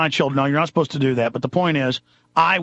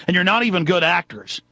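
usual speaking voice

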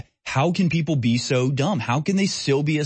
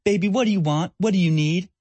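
put-on a mother sweetly coaxing a fussing baby, higher; cooing mother; 7 semitones higher than usual. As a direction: imitate a mom soothing her child in a sing-song lilt. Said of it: "and she's like".

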